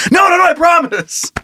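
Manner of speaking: high, frantic mock denial; panicked pleading. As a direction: beg the hunter to believe you. Suspect pleading with the hunter.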